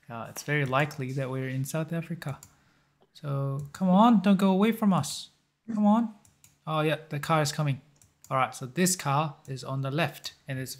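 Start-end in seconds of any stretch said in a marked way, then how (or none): 3.7-6.1 louder, higher urging at the screen; frustrated urging. Real, not acted. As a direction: push it along, come on come on. Urging the car while playing.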